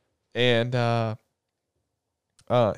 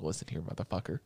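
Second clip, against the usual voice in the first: low menacing retort; 8 semitones lower, 12 dB quieter than usual. Retold or imitated. Friend turned girl retorts.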